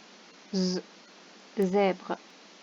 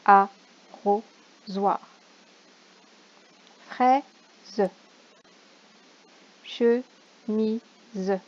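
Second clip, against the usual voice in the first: each syllable drawn out slowly and louder; slow syllable sounding; 4 dB louder, much slower than usual. Shown as a demonstration. Teacher to pupil at home, slow syllable sounding. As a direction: sound out ar-ro-soir for a child. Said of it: "teacher sounds out word".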